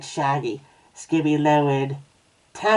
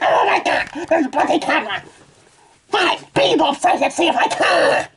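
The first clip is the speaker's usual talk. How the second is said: very high, loud tantrum; exasperated shouting; 13 semitones higher, 5 dB louder than usual. Acted. Reader gives up fixing the camera, shouting in exasperation. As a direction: shout in a high-pitched fit of frustration.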